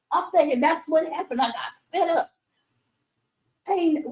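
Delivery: usual speaking voice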